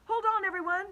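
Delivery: high, loud call. A narrator's reading. James warning his friends, loud call. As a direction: call to everyone to brace. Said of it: "James called out".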